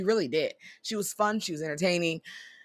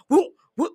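high, loud whoop-whoop punch noises; mock punch sounds; 12 semitones higher, 8 dB louder than usual. Telling viewers how the fight went, loud high whoop punch noises. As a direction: smack out the hits like a cartoon fight.

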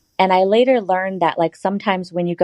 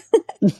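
laughing; 4 semitones higher than usual. Real, not acted. Laughing at the memory.

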